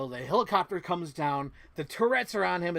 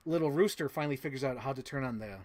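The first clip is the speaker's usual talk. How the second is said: ordinary talk, nothing marked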